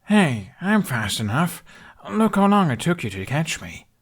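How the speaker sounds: put-on a boy bragging back; young Hiccup, teasing. A narrator's reading. Hiccup teases the dragon about the chase, boyish and protesting.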